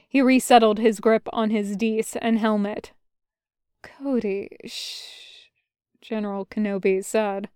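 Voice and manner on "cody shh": very soft, fading into a breath; faint murmur trailing into a whispered shush; delirious murmur. A narrator's reading. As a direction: hush someone tenderly, half-conscious. Injured general murmurs in delirium.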